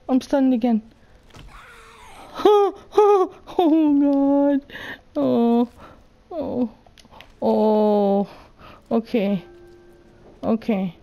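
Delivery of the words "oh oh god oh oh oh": high, loud laughing cries; panicked wail. Real, not acted. Player stunned again by zombies, panicked wail. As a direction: cry out loud in helpless panic.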